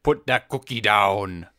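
low, slow Austrian accent impression; Schwarzenegger impression. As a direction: growl it like Arnold, lightly. Quoting Schwarzenegger line.

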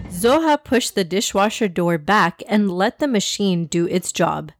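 usual speaking voice

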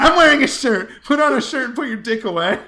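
very loud, high-pitched comic yelling; balcony shout. Acted. Man on balcony answering jeering boys, full comic shout. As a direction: bellow it down to the street. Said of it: "I should just shout at them".

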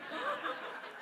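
high breathy giggle; amused chuckle at the memory; real laugh. Real, not acted. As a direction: let a light laugh slip out. Laughing at own story.

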